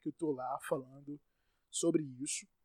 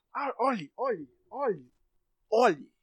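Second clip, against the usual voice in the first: exasperated; 6 semitones higher, 7 dB louder, much slower than usual. Real, not acted. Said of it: "meu sotaque só aparece quando eu tô puto".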